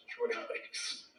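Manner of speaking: breathy whisper, slow; whispered aside. Real, not acted. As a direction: whisper the town name.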